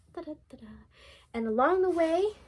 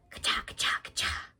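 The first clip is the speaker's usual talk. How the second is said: whispered chopping sound; 4 dB quieter than usual. Acted. Woodsman fights the wolf.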